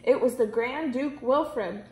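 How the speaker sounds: ordinary delivery, nothing marked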